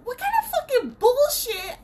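high, loud indignant rant; outraged rant. Real, not acted. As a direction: vent your outrage at the vet. Ranting about vet wait.

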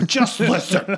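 high, loud, drawn-out demand; exasperated interruption. Retold or imitated. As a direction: cut in as an impatient stepdad. Stepdad cuts off the objection.

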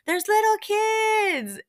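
high, slow, drawn-out warning cry; stretched, high-pitched protest; mock urgent call. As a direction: imitate calling out to stop a fight. Acted cry to stop the fight.

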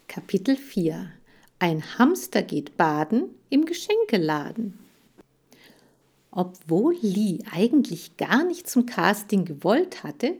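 ordinary delivery

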